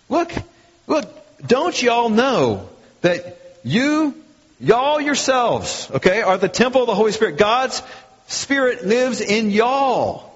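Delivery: high, stretched-out Southern drawl. Acted. Preacher voicing Paul to the whole church, Southern drawl. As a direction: draw out each y'all like a Southerner. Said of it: "Paul's speaking Southern".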